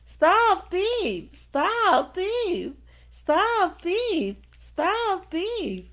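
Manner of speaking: loud angry shouts of stop thief; furious gardener yelling; calling out. A narrator's reading. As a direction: bellow after the thief, high and loud. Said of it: "calling out".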